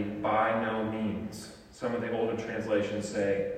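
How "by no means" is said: slow, weighty By no means, stress on By; a solemn, deliberate denial; emphatic. Acted. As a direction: lay each word down firmly and slowly. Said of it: "Paul will answer emphatically".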